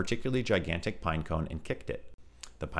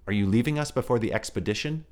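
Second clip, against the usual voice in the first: put-on baby kangaroo voice; 5 semitones higher, 4 dB louder than usual. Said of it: "asked the kangaroo".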